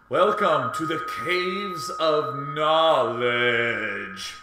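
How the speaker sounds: slow, grand echoing announcer; big hall echo. Pretend. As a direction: proclaim it like a cave oracle. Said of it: "Here's the echo".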